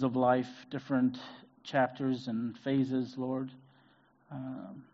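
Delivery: soft, earnest prayer voice; hushed pastoral prayer. Acted. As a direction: pray quietly over the congregation. Leads prayer.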